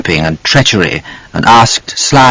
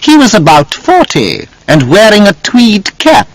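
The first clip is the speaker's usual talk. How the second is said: malicious; 11 semitones higher than usual. A narrator's reading. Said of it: "with a malicious smile".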